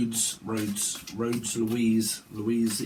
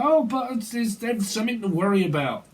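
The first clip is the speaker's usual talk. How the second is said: put-on worried old mother; 8 semitones higher than usual. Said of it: "It's like".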